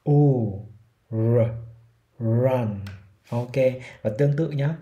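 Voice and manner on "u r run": "U, r, r, run": a rounded u, held r, then run, each step separated by pauses; three-step r drill. Shown as a demonstration. Teacher drills run in three counted steps. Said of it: "Ok, easy chưa?".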